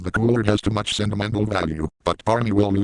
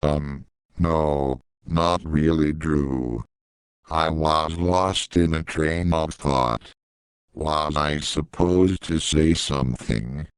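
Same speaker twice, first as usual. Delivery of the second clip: lower, slow, dazed drawl; Barney voice; 5 semitones lower, much slower than usual. Acted. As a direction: answer Drew as if lost in thought, slowly.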